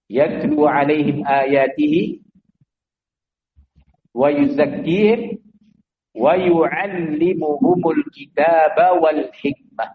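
slow, drawn-out Arabic recitation; Quranic recitation. Acted. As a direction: intone the verse slowly and reverently.

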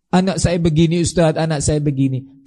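ordinary talk, nothing marked

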